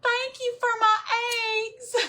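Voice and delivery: high, hammed-up Southern accent; exaggerated Southern belle, pitched high; Southern accent in character. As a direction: play up a sweet Southern thank-you. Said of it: "have to go into character".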